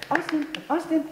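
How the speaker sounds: high, hushed little dog call; coaxing the dog. Acted. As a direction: call the dog high, without waking her. Calling the dog while she sleeps.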